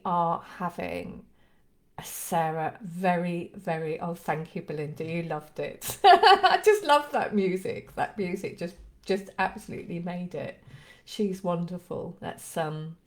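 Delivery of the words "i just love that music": laughing. Real, not acted. Laughing delight at the music.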